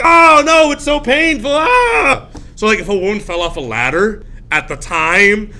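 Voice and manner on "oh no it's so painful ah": high, loud put-on agony; hammed-up agonized shriek; mock cry of pain. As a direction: wail as if in pain, rising to a scream. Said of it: "the woman might be like".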